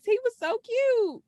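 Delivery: high, drawn-out squeal of adoration; gushing. Real, not acted. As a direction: coo over something adorable. Gushing over a cute detail.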